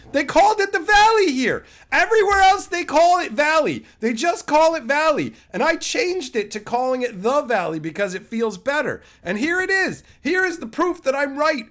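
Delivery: loud, high and vindicated; triumphant rant. Real, not acted. Streamer gloating to chat about the valley name, loud and triumphant. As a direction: crow that you were right all along.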